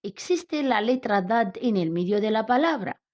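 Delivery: ordinary talk, nothing marked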